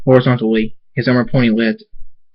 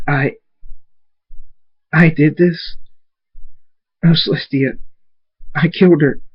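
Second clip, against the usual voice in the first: higher, halting disbelief; horrified shock; 5 semitones higher than usual. A narrator's reading. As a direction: falter as the horror dawns. Thunderstorm realizes in shock what happened.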